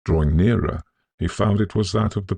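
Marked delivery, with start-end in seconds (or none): none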